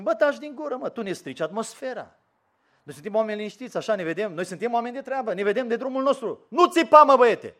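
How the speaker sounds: annoyed bystanders shushing, ending in a loud yell; irritated crowd. Retold or imitated. Crowd scolding Bartimeu for his noise, irritated hushing.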